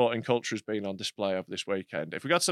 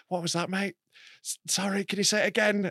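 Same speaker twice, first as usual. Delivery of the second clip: hapless English prick; 7 semitones higher than usual. Retold or imitated. English compere asking a Glasgow crowd member to repeat, sheepishly. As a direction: imitate a timid, apologetic Englishman. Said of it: "you just look like an English prick".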